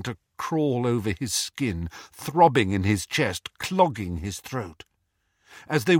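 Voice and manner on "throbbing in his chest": ordinary delivery, nothing marked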